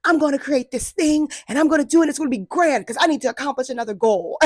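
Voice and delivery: high, loud, fast and eager; excited self-talk. Retold or imitated. Imitating her past self excitedly launching a grand plan. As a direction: hype yourself up, racing ahead. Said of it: "I was like".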